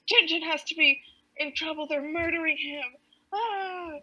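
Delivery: high-pitched, hammed-up alarm; mock panic. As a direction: imitate a panicked cry for a friend in danger. Acts out Haddock panicking over Tintin.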